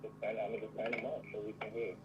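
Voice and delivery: muffled remote voice. Real, not acted. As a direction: voice muffled by headphones. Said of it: "It's just a little muffled".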